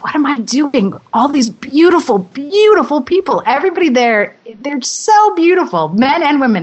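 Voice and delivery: high-pitched, starstruck gush; acted-out wonder, stretching the praise; gushing awe. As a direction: marvel with swooping high emphasis. Acting out awe at gorgeous co-stars.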